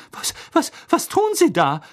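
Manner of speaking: high, stammered disbelieving question; aghast, pitch shooting up; flustered shock. Acted. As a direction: stumble into a startled demand. Shocked professor questions Moody.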